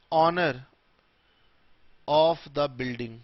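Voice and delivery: slow, long pause after every word; word-by-word dictation. Shown as a demonstration. Teacher dictating a definition to students, word by word. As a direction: dictate it one word at a time.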